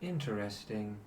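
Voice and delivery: measured, grave and patient; celestial angel. Acted. GM voices the celestial.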